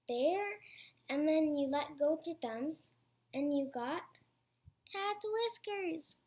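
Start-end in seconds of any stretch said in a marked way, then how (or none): 4.9-6.1 higher, slower proud naming of the finished figure; ta-da reveal, higher and slower; sing-song reveal. Real, not acted. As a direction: present it with a flourish.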